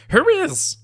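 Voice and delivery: high, loud squeaky kobold cry; shrill little lizard challenging intruders; shouted kobold squeak. A narrator's reading. Kobold guard shouting down at intruders, squeaky and high. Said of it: "shouted the kobold".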